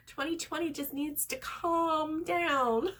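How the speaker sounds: high, slow, drawn-out plea; joking, hammed-up complaint; mock exasperation. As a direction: stretch it out in playful exasperation. Joking scolding of the year.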